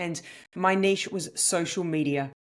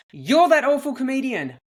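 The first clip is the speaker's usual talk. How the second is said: higher, louder pointed jab; retold accusation; 7 semitones higher, 8 dB louder than usual. Comedian quoting a woman at the buffet, loud accusation. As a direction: imitate an outraged passenger calling someone out. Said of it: "A British woman looks at me and says".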